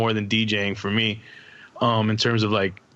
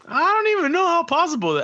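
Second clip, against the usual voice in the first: incredulous. Real, not acted. Disbelief at one-arm DJing.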